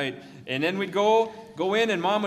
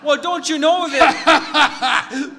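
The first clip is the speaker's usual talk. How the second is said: slow, drawn-out Minnesotan; Minnesota accent; 6 semitones higher, 8 dB louder, much slower than usual. Acted. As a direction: lean hard into the Minnesota twang. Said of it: "the funny accents".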